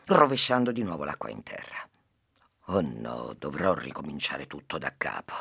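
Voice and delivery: lively storytelling to a listener; story narration. Acted. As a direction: tell the fable with animated character voices. S0 tells a fable. (narrazione di una fiaba)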